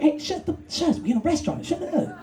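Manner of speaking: hushed scold. Retold or imitated. Comic hushing ex in a restaurant, high hushed scold. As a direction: hush him in the restaurant. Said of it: "I'm like".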